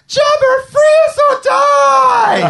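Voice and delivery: high, loud heroic shout; Luke shouting. Pretend. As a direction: demand it like a hero. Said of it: "imagine if he was like".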